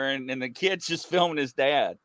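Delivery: usual speaking voice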